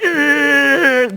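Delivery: sustained mock growl; imitated angry growl. Showing how the angry customer acted, imitated growl. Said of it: "he was so angry".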